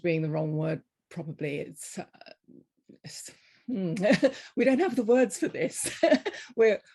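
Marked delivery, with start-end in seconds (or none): 4.0-4.5 bright burst of laughter; laughing. Real, not acted. Laughs at lacking words. 4.6-6.8 laughing through the words; amused, higher and louder. Real, not acted. Laughing while admitting no words.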